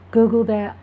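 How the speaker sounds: ordinary talk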